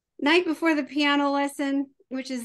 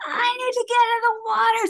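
put-on urgent kid; 11 semitones higher, 5 dB louder than usual. Kid voiced in story.

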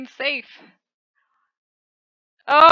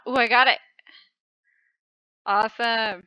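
ordinary talk, nothing marked

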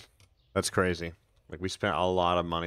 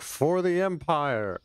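mock battle cry; 9 semitones higher, 5 dB louder, much slower than usual. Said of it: "And I'd be like".

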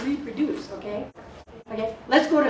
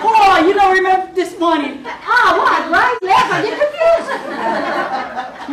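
high and loud, laughing through the words; 7 semitones higher, 8 dB louder, slower than usual. Real, not acted. Mocking college theories of self, laughing high and loud. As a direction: giggle your way through the confusion.

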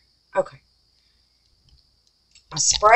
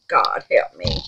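ordinary talk, nothing marked

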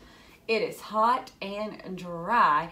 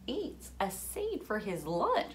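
ordinary delivery, nothing marked

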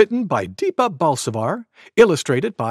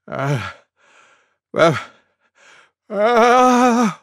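spaced gasps building louder; elephant's sneeze wind-up; 7 semitones higher, 5 dB louder, much slower than usual. A narrator's reading. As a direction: pretend ah-ah before a sneeze. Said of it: "went Elephant".

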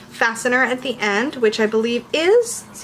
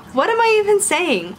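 amused disbelief; 9 semitones higher than usual. Real, not acted. Laughs at own rambling.

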